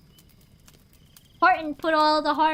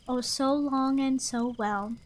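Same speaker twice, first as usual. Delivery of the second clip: ordinary delivery, nothing marked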